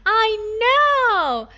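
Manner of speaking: put-on high, bright child exclaiming; light up with a new idea; excited little boy. Daniel thinking of a game, high excited child voice. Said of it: "Daniel said".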